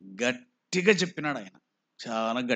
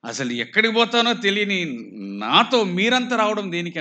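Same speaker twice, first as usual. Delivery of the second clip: raised, louder voicing of a questioning speaker; louder, higher rhetorical challenge; 6 semitones higher, 4 dB louder than usual. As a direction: voice David's challenge with raised pitch. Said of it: "దావీదు అన్నట్లుగా".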